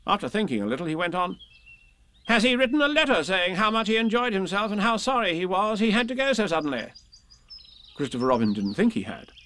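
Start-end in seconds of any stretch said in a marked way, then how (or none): 2.3-6.9 high, held-up pitch; indignant storybook rabbit; fussy Rabbit. Acted. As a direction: question him fussily in a high rabbit voice. Rabbit questions Christopher Robin.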